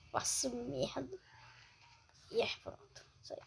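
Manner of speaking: soft unvoiced whisper. Real, not acted. Quiet aside while glitching.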